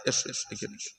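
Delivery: hushed, coaxing come here; beckoning whisper. Retold or imitated. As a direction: draw him in with a whisper. Cleric beckons the sinner close.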